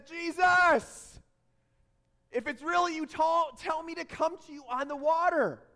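loud, high shout across water; calling out urgently. Acted. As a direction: yell the name, then plead at full voice. Peter yelling to Jesus from boat.

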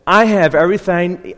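accidental Southern twang; Southern accent. Real, not acted. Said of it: "I just became Southern now".

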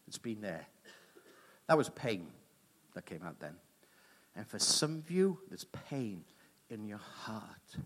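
solemn preaching. Acted.

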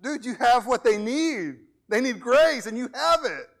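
a hammed-up believer urging a lazy one; urgent plea. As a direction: press the lazy minister hard. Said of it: "You're like".

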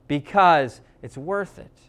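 usual speaking voice

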